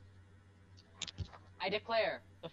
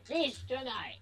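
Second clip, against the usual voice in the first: a higher war cry; intimidating yell; 6 semitones higher, slower than usual. Acted. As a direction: roar it to scare the enemy. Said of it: "I yelled that in an intimidating voice".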